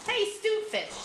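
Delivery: high-pitched storybook call. A narrator's reading. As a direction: call over to someone as the whale. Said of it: "The whale then called".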